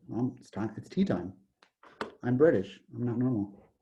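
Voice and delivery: lightly put-on British accent, low and soft. Curve explains it is tea time, mock British accent. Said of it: "'cause he's British".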